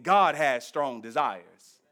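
usual speaking voice

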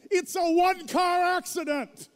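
loud, high, incredulous shout at the son; furious father yelling at his son over the crash; retold angry yell.

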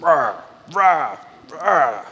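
short imitated angry growls; mock intense roar. Husband venting alone in the bathroom, mock intense roaring. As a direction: let out mock roars of frustration. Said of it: "like, I'm intense".

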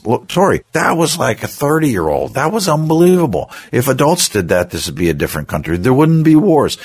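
put-on high, gushing praise for a child; enthusiastic praise. Dad praising Tori for staying calm, gushing and high. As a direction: pile on the praise, going overboard.

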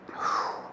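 long breathy exhale; heavy sigh. Real, not acted. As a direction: let out a slow sigh. Pausing, moved by the memory.

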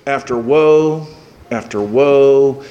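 drawn-out woe after woe; slow, heavy intoning. Acted. Preacher recalling Jesus' woes, slow and heavy. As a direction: let each woe land slowly.

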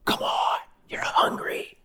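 soft unvoiced Satan whisper; Satan whispering temptation to hungry Jesus; whispering tempter. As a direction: whisper it like the devil in his ear.

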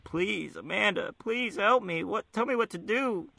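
high, soft mock pleading; whimpering begging. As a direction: beg pathetically on your knees. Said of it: "like a little bitch saying".

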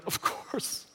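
hushed realization. Retold or imitated. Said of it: "I had to reread it and be like".

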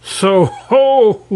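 high, loud kingly yell. Acted. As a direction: bellow it out. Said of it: "yelled the king".

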